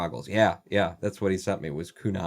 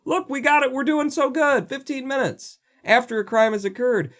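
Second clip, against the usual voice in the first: much higher, mock-proud police voice; proud police boast. Mocking Detroit police bragging about response times. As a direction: hold it up like a banner, beaming. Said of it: "they hold that up like a banner".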